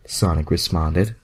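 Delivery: usual speaking voice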